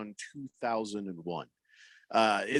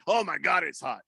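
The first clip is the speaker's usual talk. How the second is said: mock exclamation; 2 semitones higher than usual. Shown as a demonstration. Radio host showing an overblown heat complaint, mock exclamation. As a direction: exaggerate the complaint, then let it fall. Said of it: "But you can't say".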